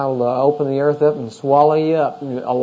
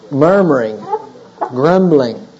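normal talking voice

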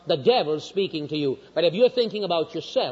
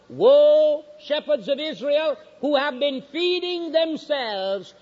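high, measured pulpit reading; slow, ringing Bible reading; scripture proclamation; 7 semitones higher, slower than usual. Real, not acted. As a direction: proclaim it like a prophet, stretching the words. Reads scripture aloud to congregation.

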